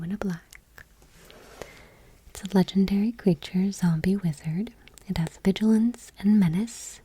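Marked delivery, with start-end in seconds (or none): none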